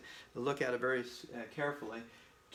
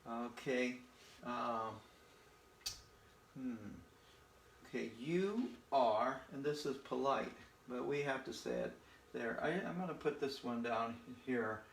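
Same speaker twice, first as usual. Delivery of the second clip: normal talking voice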